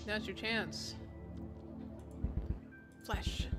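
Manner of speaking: hushed storyteller narration; story narration. A narrator's reading. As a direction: read it like a spooky bedtime tale. Streamer narrates story scenes.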